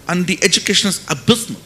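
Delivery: ordinary talk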